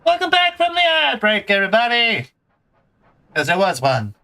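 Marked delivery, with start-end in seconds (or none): 0.0-2.3 high-pitched, hyped stream greeting; exuberant welcome back. Real, not acted. Streamer announces return from ad break.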